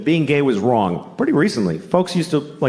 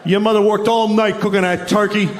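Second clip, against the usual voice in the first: a hint of a yelling father, pitched up; half-hammed angry dad rant; mock angry dad; 8 semitones higher than usual.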